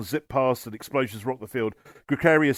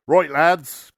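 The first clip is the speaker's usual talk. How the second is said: loud, raised orc boss call; rallying call; 4 semitones higher, 7 dB louder than usual. A narrator's reading. Orc boss to his mob before battle, rallying. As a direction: call the lads to order.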